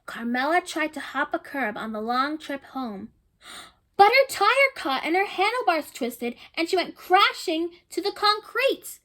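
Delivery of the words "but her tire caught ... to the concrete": dramatic telling. A narrator's reading. Dramatic crash in story.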